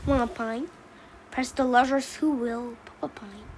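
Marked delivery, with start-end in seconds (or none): none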